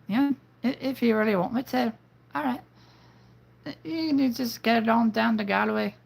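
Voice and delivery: high-pitched female voice with an Irish accent. Shown as a demonstration. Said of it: "female voice mixed with an Irish accent".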